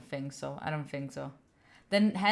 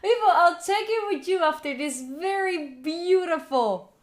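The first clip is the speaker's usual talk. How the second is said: mock crying; 6 semitones higher than usual.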